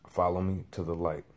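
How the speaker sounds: soft, controlled voice, lightly imitated; voice of God. Man demonstrating the voice he heard, quiet measured tone. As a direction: drop the volume and quote it evenly. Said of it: "It said it in that specific tone".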